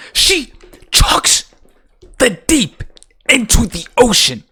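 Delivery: high, punched-out words with pauses; exasperated, halting disbelief; incredulous emphasis. Real, not acted. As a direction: hammer each piece of the absurdity separately. Incredulously spelling out the plot blunder.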